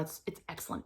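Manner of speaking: soft whispered praise; hushed awe. Real, not acted. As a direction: breathe it out like a secret. Gushing over the book.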